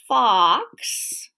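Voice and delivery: Fox with the ah drawn out; stressed middle ah. Shown as a demonstration. Teacher to children pointing out the middle sound, stressed ah. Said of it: "has an ah in the middle".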